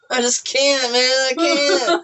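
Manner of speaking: high, whiny mock despair; squeaky comic lament; Eugene. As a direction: imitate a buddy giving up in disbelief. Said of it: "He's like".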